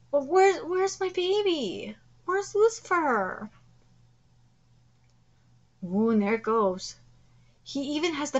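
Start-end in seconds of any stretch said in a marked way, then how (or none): none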